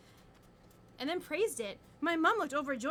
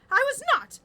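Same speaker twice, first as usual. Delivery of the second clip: high, loud outraged denial; indignant denial; 10 semitones higher, 12 dB louder than usual. A narrator's reading. Claire denies being scared, loud and indignant. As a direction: snap back, much higher and louder. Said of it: "Claire denied".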